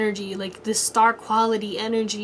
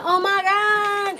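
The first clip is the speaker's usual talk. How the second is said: high, drawn-out fan squeal; mock an adoring crowd, stretched and high; hammed-up awestruck gushing; gushing praise; 10 semitones higher, much slower than usual. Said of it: "they're, like, praising her".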